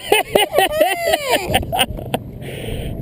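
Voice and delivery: high whooping laugh of joy; delighted high laugh. Real, not acted. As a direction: laugh out in triumph. Excited catch, laughing loud.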